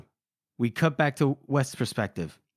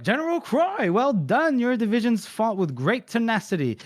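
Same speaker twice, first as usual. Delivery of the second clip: nervous officer voice; 6 semitones higher than usual. Acted. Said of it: "Wes is like".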